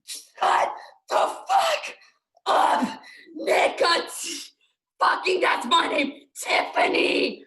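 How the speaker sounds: furious, loud scolding yell; angry shout. Acted. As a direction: snap at her for getting the name wrong. Acted quarrel over her name.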